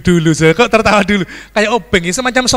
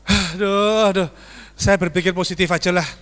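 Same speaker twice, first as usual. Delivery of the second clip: ordinary delivery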